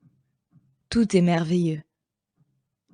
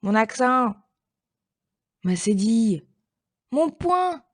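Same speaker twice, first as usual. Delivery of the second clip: calling louder and louder; 4 semitones higher than usual. Acted. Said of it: "de plus en plus fort".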